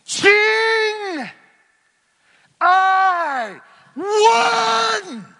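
triumphant shout. Retold or imitated. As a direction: bellow it as the conquering king. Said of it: "pardon the expression".

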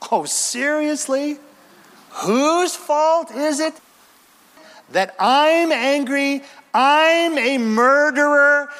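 high, slow, sarcastic disbelief; sarcastic preacher aping Cain; mock indignation. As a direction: drag it out, mocking the excuse. Preacher mocking Cain's blame-shifting.

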